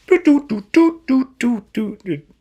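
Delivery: high, loud doot doot beeps; flip phone dialing. Retold or imitated. Mickey dialing his dad, high phone beeps. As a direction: beep out each key like an old phone. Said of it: "like it was a flip phone".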